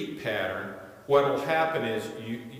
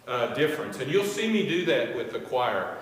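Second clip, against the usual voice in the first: ordinary talk, nothing marked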